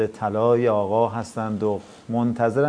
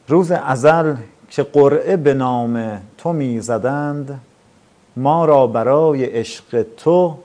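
slow, louder recited verse; poem recitation; 3 semitones higher, 5 dB louder, slower than usual. Acted. Host closing the show with a devotional poem, recited. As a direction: recite the verse slowly with feeling.

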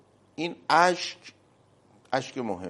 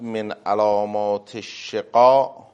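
solemn hadith recitation; 11 dB louder, much slower than usual. Real, not acted. Quoting hadith in Arabic.

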